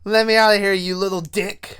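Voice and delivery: plead, then demand to be let out; Chucky calling from closet. Acted. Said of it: "he hears Chucky in there like".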